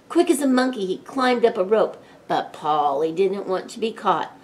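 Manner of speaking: plain reading voice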